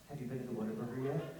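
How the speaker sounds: low, hushed, rapid question; wild-eyed intensity. Retold or imitated. Every Texan asking a visitor, wild-eyed and hushed. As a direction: ask it fast and low, like a wild-eyed fanatic. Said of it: "will ask you wild-eyed like this".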